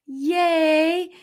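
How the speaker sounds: high bright cheer of joy; squealed yay; excited yay. Real, not acted. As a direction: cheer for the viewer. Cheering a viewer's comment.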